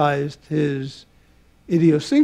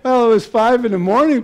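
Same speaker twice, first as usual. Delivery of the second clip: laughing; 6 semitones higher, 5 dB louder, faster than usual. Real, not acted. Laughing after the accent impression.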